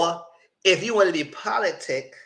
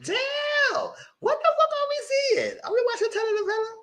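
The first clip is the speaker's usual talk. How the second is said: much higher, squeaky incredulous voice; mock disbelief. Host retelling her own reaction to the soap-opera scene, high disbelief. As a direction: gasp it out, cracking up at the drama. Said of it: "I said".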